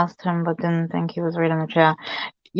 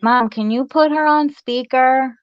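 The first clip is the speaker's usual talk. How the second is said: put-on higher, eager kid's request; imitating her kids; 7 semitones higher than usual. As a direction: voice a child pleading with mom. Voicing her kids asking mom.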